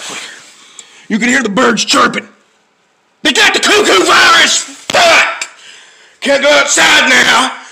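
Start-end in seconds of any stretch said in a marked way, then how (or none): 3.2-5.5 high, exasperated rant; higher-pitched angry cursing; angry outburst. Real, not acted. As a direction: burst out in frustration. Angry rant about the virus.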